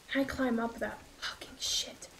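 hushed, breathy whisper to self; real nervous whisper; wary whisper. Real, not acted. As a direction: whisper it under your breath, very softly. Scared, trying to stay quiet.

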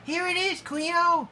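very high-pitched excited cry. A narrator's reading. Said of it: "he cried".

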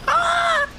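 startled yelp. Real, not acted. Sudden scare in game.

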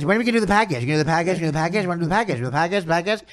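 a hammed-up chorus of pestering; nagging mock pester. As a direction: badger him over and over about the package. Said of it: "Everyone says".

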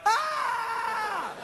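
squealing high yelp of shock; man yelping after wife says he hit her. Retold or imitated. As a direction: let out a high girly yelp. Reaction to being hit.

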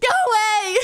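put-on high, loud drunk yell; hammed-up weepy outburst; mock tearful shout. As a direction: shoo the intruder through fake sobs. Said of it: "you're a sobbing mess".

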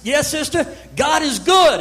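loud, high joyful shout. Retold or imitated. Man shouting praise to the nurse, loud and high. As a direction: shout your praise at her. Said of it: "And I'm shouting this".

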